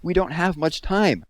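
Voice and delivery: an anxious, pressing plea; urgent. A narrator's reading. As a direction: urge him to act now. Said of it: "urged Catherine".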